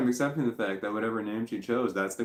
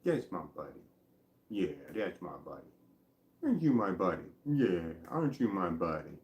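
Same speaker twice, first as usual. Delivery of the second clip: soft, lower cooing to a dog; doting dog talk; 4 semitones lower, 5 dB quieter than usual. Retold or imitated. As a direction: coo softly to the pup. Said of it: "and I say".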